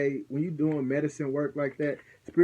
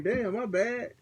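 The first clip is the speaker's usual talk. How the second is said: sheepish apology; 9 semitones higher, slower than usual. Retold or imitated. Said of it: "He looked like".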